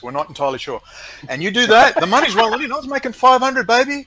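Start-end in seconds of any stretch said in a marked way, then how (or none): 1.3-4.1 high, loud gleeful boast; excited bragging. Real, not acted. To the host about the easy cash, gleeful high boast. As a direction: crow about the money.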